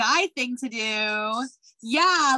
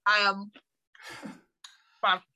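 talking while chewing food; mouth full; 6 semitones lower than usual. Real, not acted. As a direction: speak through a mouthful. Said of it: "I'm eating".

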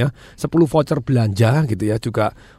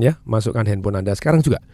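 ordinary talk, nothing marked

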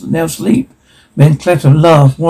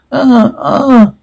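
two high wailing "ah"s; mock pained cries; 9 semitones higher than usual. Reader voicing Saxons screaming in pain, high mock cries. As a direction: cry out high, without added volume.

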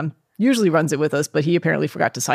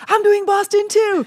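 much higher, slow, louder delighted squeal; surprised excitement; 4 dB louder, slower than usual. Retold or imitated. Cousin revealing she's doing Boston too, high slow squeal. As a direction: squeal it out in disbelief. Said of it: "She's like".

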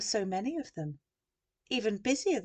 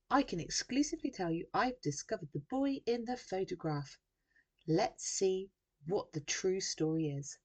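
TV reporter; 2 semitones higher than usual. A narrator's reading. Journalist to viewers live from the beach, reporter delivery.

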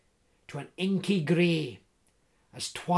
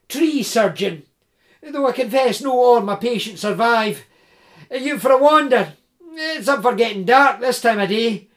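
big hearty Scots voice, louder and higher; jovial Scottish woodsman; 4 semitones higher, 9 dB louder than usual. Acted. Tree surgeon teasing Jim in the dark woods, hearty Scots. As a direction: tease him like a cheerful giant. Big Sandy introduces himself.

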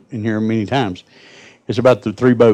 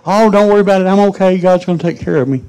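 put-on a much higher, louder old lady brushing off the rescuers; stubborn lady's voice; 10 semitones higher, 4 dB louder than usual. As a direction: play the stubborn church lady, sure God will save her. Lady refuses helicopter rescue.

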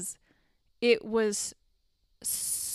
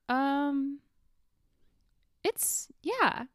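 higher-pitched, halting reply with a long pause; imitated awkward hesitation; 5 semitones higher, much slower than usual. Imitating the girlfriend's reaction to his song, awkwardly hesitant. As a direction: mimic someone unsure what to say. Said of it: "his girlfriend or whatever she is, is just like".

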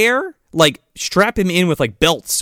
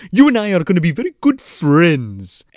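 put-on villain voice; 4 semitones higher than usual. Villain Barnaby quoted in voice.

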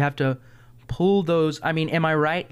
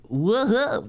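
high-pitched goofy noise; 8 semitones higher than usual. Shown as a demonstration. Voice actor showing what people think is enough, goofy. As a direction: throw out a silly cartoon whoop. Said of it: "if you can just be goofy".

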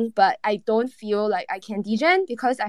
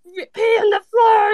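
high, slow shouted slogan; excited shout; 11 semitones higher, 7 dB louder, slower than usual. Retold or imitated. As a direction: belt it out like a rallying cry. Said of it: "a project that's like".

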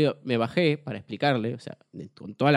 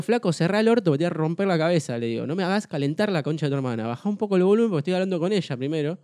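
higher-pitched, heated retelling of a threat; retold worked-up anger; 4 semitones higher than usual. Retelling his outburst at a heckler, worked-up anger. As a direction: tell him off, fed up and fired up. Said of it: "Le digo así ya resacado".

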